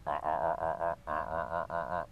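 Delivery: low, soft goose gabble; feeding gabble. Shown as a demonstration. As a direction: murmur low quick ahs like feeding geese. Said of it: "basically that same".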